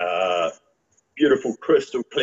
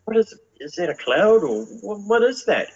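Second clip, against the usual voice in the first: higher, rising amazed question; retold amazement; 9 semitones higher than usual. Man recalling misty lights in the sky, amazed and higher. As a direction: ask it like you can't believe your eyes. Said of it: "And I'm like".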